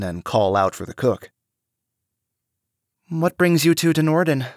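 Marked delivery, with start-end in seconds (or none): 3.1-4.5 higher, lightly curious; curious young woman. A narrator's reading. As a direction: ask it casually, sipping tea. Cassie asking.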